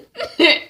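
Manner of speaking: high burst of laughter; short laugh. Real, not acted. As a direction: laugh out loud.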